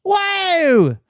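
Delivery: very high, slow, squealing mock British gush; high-pitched British squeal. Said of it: "Most of them are the high pitched".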